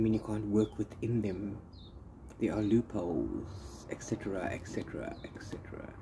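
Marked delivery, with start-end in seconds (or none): 2.4-5.9 et cetera trailing off into a whisper; fading to a whisper. Shown as a demonstration. Booktuber trailing off into a whisper about loopholes. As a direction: let each et cetera fade softer until breathless. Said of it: "trails off into a whisper".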